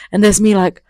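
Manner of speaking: ordinary talk, nothing marked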